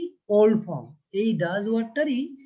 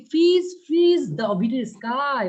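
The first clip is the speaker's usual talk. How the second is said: louder, slow declaiming of the verse; poem recital; 4 semitones higher, 6 dB louder, much slower than usual. Shown as a demonstration. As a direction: call out the line slowly, like a poem. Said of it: "বলা হচ্ছে কি".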